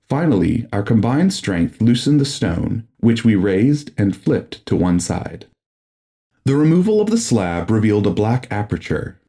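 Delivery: plain reading voice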